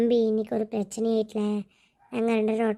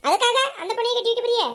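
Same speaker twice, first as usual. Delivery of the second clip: high, louder outraged question; indignant protest; 10 semitones higher, 3 dB louder than usual. Acted. Wife scolding husband over son's bride, indignant high protest. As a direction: throw the question at him in outrage.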